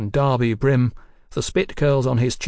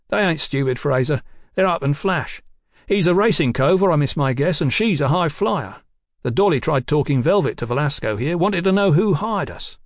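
higher, louder streetwise informant; cheeky rogue detective; 5 semitones higher, 4 dB louder than usual. Acted. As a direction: spill the news with cocky relish, pitched up. Voices Tate describing the couple.

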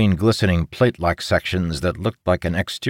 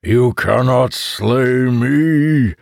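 slow, drawn-out monster taunt, pitched higher; bellowing monster; 4 semitones higher, 4 dB louder, much slower than usual. A narrator's reading. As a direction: bellow it long and mocking, as a beast. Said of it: "the monster bellowed".

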